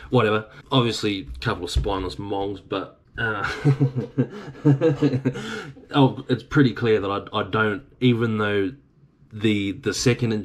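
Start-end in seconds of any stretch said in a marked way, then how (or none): 3.5-5.9 high-pitched run of chuckles; long, high chuckle. Real, not acted. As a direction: laugh it off in a rippling giggle. Laughs after insulting the owners.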